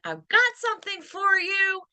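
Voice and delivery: upbeat, lifted. Shown as a demonstration. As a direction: sing the words up high and happy. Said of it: "is different than".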